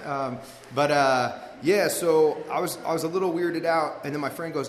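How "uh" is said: normal talking voice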